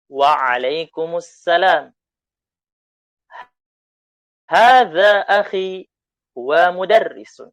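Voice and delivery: slow, loud, carefully spaced Arabic; model reading. Shown as a demonstration. Teacher reading the dialogue for students to repeat, slow and loud. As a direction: read it out slowly so the class can copy.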